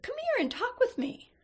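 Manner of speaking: put-on high-pitched little old man's invitation; easy, friendly beckoning. A narrator's reading. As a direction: coax him over in a high, lilting voice. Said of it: "said in the easy vernacular".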